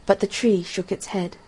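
ordinary delivery

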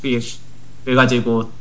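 normal talking voice

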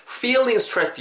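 "feeling" begun with a clear F; teacher's model "feeling stressed" with real F; clear F sound. Shown as a demonstration. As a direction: keep the F alive in "feeling". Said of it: "F 사운드 살리셔야 됩니다".

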